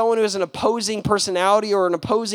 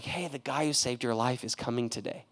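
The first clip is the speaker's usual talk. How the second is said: much softer, hushed news; soft nurse; 8 semitones lower, 11 dB quieter than usual. Retold or imitated. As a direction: tell the patient quietly. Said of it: "the nurse is like".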